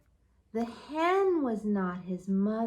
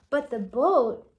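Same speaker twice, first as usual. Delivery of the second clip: usual speaking voice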